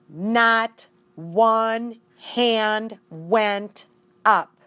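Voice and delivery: slow, loud, stunned narration; dramatic slowdown. A narrator's reading. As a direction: draw each word out for disbelief.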